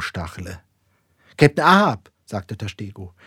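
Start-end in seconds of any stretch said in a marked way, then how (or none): 1.4-2.1 raised, loud address; calling the captain. A narrator's reading. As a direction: hail the captain firmly.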